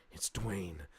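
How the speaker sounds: quietly offered name; soft name drop. Retold or imitated. Hicks repeats his name.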